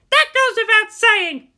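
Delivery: high, loud, slow huffy retort; indignant shout. Acted. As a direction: snap back indignantly.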